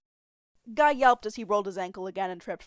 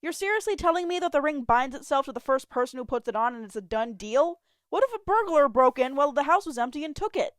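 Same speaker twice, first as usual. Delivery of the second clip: exasperated rant; 7 semitones higher, 7 dB louder than usual. Acted.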